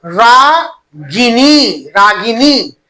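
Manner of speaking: रागिनी said very slowly, long vowels stretched; drawn-out long vowel. Shown as a demonstration. Teacher showing where the big ई falls, रागिनी drawn out. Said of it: "थोड़ा लंबा समय तीरे तो इसका मतलब".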